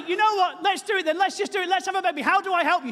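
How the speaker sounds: high, loud eager husband; a hammed-up keen dad-to-be; eager offer. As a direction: throw yourself in, all keen to help. Husband offering help in labour.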